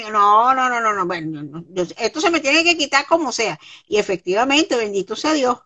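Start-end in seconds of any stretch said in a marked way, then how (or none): none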